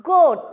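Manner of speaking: Goat said loud with a punched hard g; teacher stresses the hard g in Goat; hard g stressed. Shown as a demonstration. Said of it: "നമ്മൾ stress കൊടുക്കണ".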